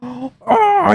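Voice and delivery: high-pitched reeing wail; high drawn-out "oh" of outrage; mock reeing cry. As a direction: screech it up at the sky. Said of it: "just reeing into the sky".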